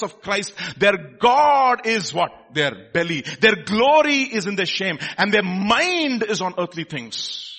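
loud, high-pitched, driving proclamation; full-force preaching. Real, not acted. Preacher tells people genuine prophets will weep, full-force preaching. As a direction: preach it hard, stretching key words.

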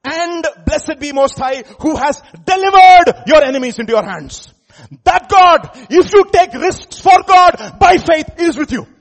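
high, shouted proclamation; full-force preaching. Real, not acted. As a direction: thunder out the blessing, stretching the words.

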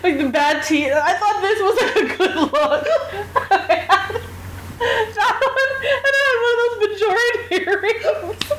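high, laughing through the words. Real, not acted. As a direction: giggle helplessly while telling it. Laughing at own teen outfit.

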